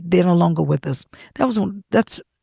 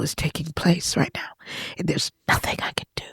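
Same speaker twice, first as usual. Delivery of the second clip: grieving whisper; 6 dB quieter than usual. Real, not acted. Grieving her brother's death.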